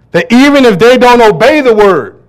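thundering sermon read; preaching at full force. Real, not acted. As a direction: proclaim it to the congregation. Preaching scripture loudly.

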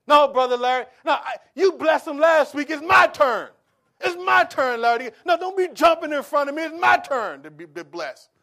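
loud, high, squabbling over whose turn; mock fight to be first to give; eager churchgoer. Churchgoer shoves Brother Larry aside to give first, loud and squabbling. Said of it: "we offend each other".

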